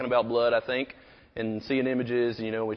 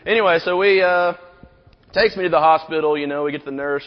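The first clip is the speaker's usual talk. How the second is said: ordinary talk, nothing marked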